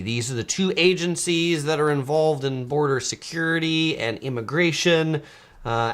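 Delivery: usual speaking voice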